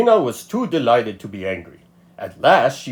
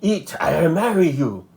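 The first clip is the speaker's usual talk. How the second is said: hissed; 7 semitones higher than usual. A narrator's reading. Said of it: "he hissed".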